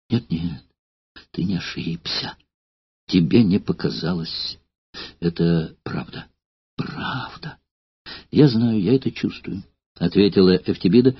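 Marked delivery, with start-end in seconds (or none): 0.1-9.6 quiet tearful answer; soft, hurt, close to tears; tearful, softer. Acted. Courtesan answering old actor through tears, softly. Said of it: "утирая горькие слёзы".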